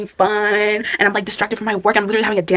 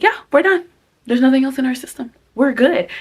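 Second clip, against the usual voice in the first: high, louder voice of her body; voicing her body; 6 semitones higher, 4 dB louder than usual. Her body answering her at night's end, high cheerful voice. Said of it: "And my body's like".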